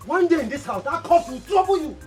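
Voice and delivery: loud, high accusing yell that keeps building; angry accusation. Acted. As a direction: shout him down as a sorcerer. Accusing the occultic man of sorcery.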